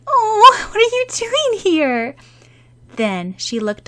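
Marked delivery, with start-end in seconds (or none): 0.0-2.1 surprised. Acted. Happy surprise at the puppy.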